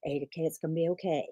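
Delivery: soft and fast, reassuring; soothing hush. Retold or imitated. Friend reassuring a panicking student, soothing hush. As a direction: gently hurry her past the panic. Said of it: "I'm just like".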